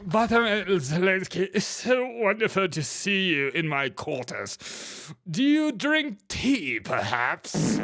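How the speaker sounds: mock royal British accent, hosting a guest; plummy British king voice, hammed up; stiff upper lip. King greeting Zelensky in his quarters, mock posh British accent. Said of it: "talk with a stiff upper lip".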